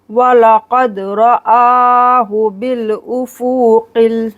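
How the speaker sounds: slow, drawn-out tajweed chant; Quranic recitation. Shown as a demonstration. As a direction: recite it slowly. Recites verse. (धीमी तजवीद तिलावत)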